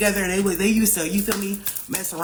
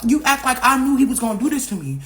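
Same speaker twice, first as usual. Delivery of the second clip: higher-pitched, indignant retold comeback; indignant retort; 5 semitones higher, 3 dB louder than usual. Daughter answering mom's scolding, indignant retort. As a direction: throw the blame right back, offended. Snapping back at scolding mother.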